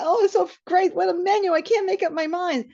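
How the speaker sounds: high, loud, giddy gushing. Retold or imitated. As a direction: gush over the menu like you're high. Stoned at restaurant, giddy.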